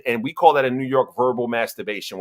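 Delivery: normal talking voice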